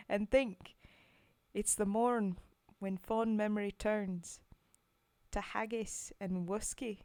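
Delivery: put-on loud, rough try at a Scottish accent; attempted Scottish accent. Streamer reading a poem to chat in an attempted Scottish accent. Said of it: "I have an attempt at an accent".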